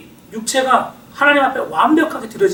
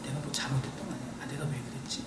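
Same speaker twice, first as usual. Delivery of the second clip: fast, much softer self-doubting mutter; 8 semitones lower, 19 dB quieter, faster than usual. Acted. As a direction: rattle off the doubts under your breath. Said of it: "또 막 이성이".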